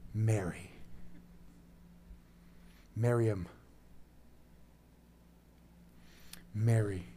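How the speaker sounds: soft, low calling of a name; familiar, gentle call. Acted. Jesus revealing himself to weeping Mary, gentle familiar call. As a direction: call her softly by name. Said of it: "In a way that she knew, a familiar word".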